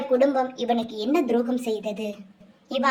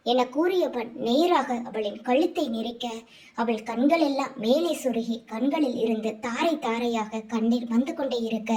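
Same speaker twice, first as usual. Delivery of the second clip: plain reading voice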